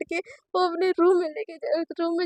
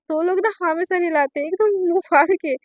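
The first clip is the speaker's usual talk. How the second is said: usual speaking voice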